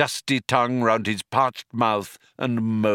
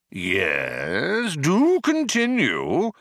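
slow, swooping alien drawl; inviting Vogon; 6 semitones higher, much slower than usual. A narrator's reading. Vogon invites Arthur to continue.

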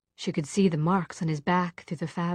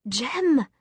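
higher, mildly raised cry of a name; 6 semitones higher, 4 dB louder than usual. A narrator's reading. Tessa calls Jem who won't look up, crying out. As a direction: call out to him, higher pitched. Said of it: "she cried again".

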